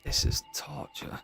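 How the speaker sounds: hushed, weary complaint; whispered groan. Pretend. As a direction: moan it under your breath. Groaning prisoner.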